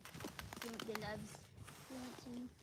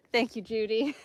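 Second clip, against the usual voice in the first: ordinary talk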